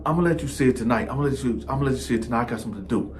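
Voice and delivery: soft, fast excuse; stalling mumble. Retold or imitated. Cellmate stalling narrator, soft and hurried. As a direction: rush the excuse out quietly.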